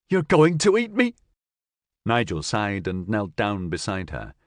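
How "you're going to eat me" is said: high, loud panicked question; terrified. Acted. Cassandra to Nigel, high loud panicked fear. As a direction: shriek it in fear.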